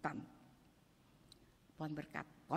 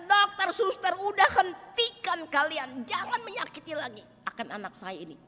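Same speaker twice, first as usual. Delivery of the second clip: loud, high, slowed plea to stop; imagined shout; 11 semitones higher, 16 dB louder than usual. Retold or imitated. Mother shouting at doctor and nurse to stop, loud. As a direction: yell at them to stop hurting her child.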